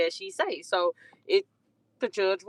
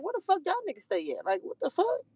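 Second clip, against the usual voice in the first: disgusted high mock outrage; 9 semitones higher than usual. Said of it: "I'm like".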